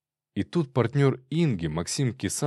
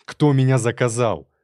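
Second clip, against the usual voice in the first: loud, furious interrogation; forceful, raised-voice demand; angry demand; 2 semitones higher, 7 dB louder than usual. Acted. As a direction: shout the question while shaking someone. Shaking robber, demanding an answer.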